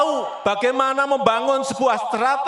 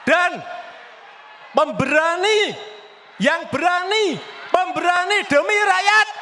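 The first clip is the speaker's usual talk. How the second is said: high fiery campaign shout; rally shout; 7 semitones higher than usual. Real, not acted. Rally speech call for courage.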